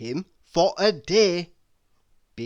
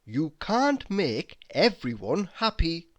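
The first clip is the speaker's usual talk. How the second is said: normal talking voice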